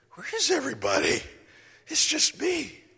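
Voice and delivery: high, loud, bewildered calling into an empty hall. Retold or imitated. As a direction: shout out, lost and alone. Dream: alone in empty auditorium.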